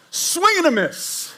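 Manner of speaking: high, slow sportscaster call; announcer call. Pretend. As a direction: call it like a ballgame. Play-by-play call.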